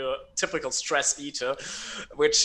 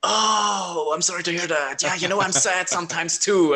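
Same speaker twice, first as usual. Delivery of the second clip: higher, louder sing-song pity; mock sympathetic friend; 6 semitones higher, 7 dB louder than usual. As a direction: parody a shallow sympathizer. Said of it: "They go like".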